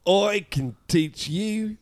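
slow, high South African shark; South African accent. Acted. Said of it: "he also is South African".

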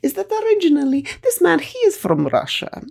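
put-on high, Russian-accented old woman explaining earnestly; granny's Russian accent. Granny telling Masha Jerry Springer's backstory, Russian-accented and earnest. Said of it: "So she would say".

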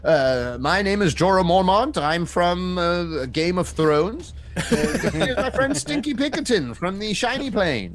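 high, hesitant introduction; Jorah introducing himself. Acted. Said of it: "And Jorah's like".

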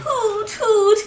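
owl hoot. Acted. Owl character hoots.

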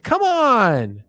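high, loud indignant whine; mock outrage. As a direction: complain to Pinhead in a high, loud voice. Mock complaint to Pinhead.

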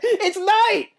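squeaky high delivery; high-pitched. Acted. Repeats line in higher voice.

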